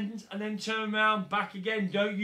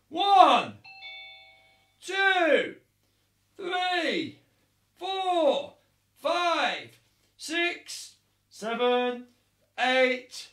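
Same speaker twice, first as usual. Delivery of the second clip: shouted count; 3 semitones higher, 5 dB louder, much slower than usual. Acted. Coach counting punches for the kids, loud shouted count. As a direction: bark each number out to the class.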